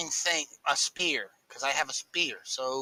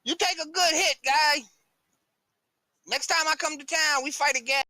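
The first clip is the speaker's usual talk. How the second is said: higher-pitched character voice; good-natured victorious fighter; 12 semitones higher than usual. Acted. Fighter to his beaten opponent after the bout, high-pitched sporting voice.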